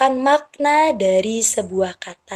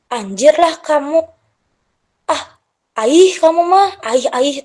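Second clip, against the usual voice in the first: lightly imitated child, higher and louder; child using slang; 5 semitones higher, 4 dB louder than usual. Teacher quoting a child's slang to parents, lightly imitated child. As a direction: exclaim like a kid tossing out slang. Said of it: "di rata-rata di anak itu".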